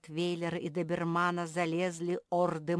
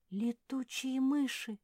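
high, slow, awed; drawn-out high exclamation of wonder; gasping amazement; 9 semitones higher, slower than usual. A narrator's reading. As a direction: gasp it in astonishment. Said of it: "ахнула Мафи".

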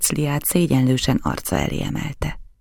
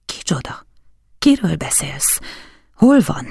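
anxious questioning; 3 semitones higher than usual. A narrator's reading. Count urgently questioning his guest.

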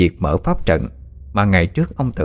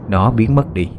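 ordinary delivery

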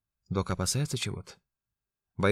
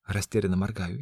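plain reading voice

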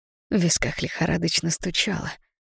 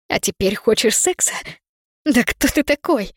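angry outcry; 4 semitones higher than usual. Acted. Angry outburst at Artyom.

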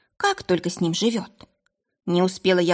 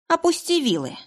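commanding shout; 10 semitones higher than usual. Acted. Commanding attacker.

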